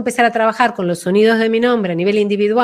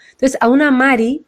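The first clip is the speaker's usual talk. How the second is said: ordinary talk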